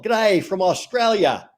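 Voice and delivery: slow, playful Aussie accent; Australian accent. Shown as a demonstration. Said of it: "Do the Australian accent again".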